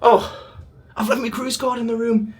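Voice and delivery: high, loud mock panic; dismayed realisation. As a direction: squeal it like you just remembered. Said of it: "I'm like".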